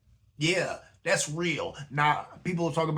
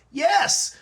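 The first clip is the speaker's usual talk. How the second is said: a sudden, much higher and louder "Yes"; emphatic yes; 8 dB louder than usual. Real, not acted. Answering his own rhetorical question with a high, loud yes. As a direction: snap the yes out high and loud as a hard confirmation.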